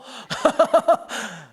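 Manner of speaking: preacher laughing at his own joke; high laugh. Real, not acted.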